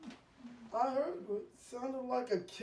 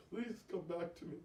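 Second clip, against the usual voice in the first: pleading softly; 6 semitones lower, 9 dB quieter, faster than usual. Acted. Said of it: "he was crying".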